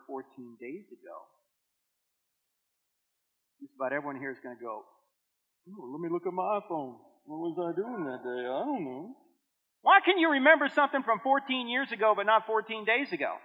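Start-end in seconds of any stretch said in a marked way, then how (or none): 5.7-9.1 softer, lightly voiced, absent-minded; a listener fumbling for his phone; mock forgetful listener.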